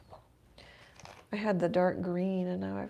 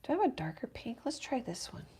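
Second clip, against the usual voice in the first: ordinary talk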